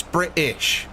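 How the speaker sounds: slow "British" with the T swallowed; drawn-out "British" with no T; dropped T. Shown as a demonstration. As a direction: say "British" as Bri-ish, glottal stop for the T. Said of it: "we don't say a T".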